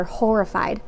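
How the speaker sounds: ordinary delivery, nothing marked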